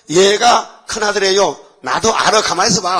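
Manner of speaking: a son arguing with his father; heated exchange. Retold or imitated. Joseph and Jacob argue. (크게 다투듯 말하기)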